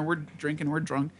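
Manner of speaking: ordinary delivery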